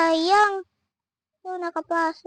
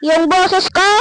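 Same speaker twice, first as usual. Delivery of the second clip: loud voice; 3 semitones higher, 8 dB louder than usual. Real, not acted. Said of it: "nahalata nyo na malakas yung boses ko".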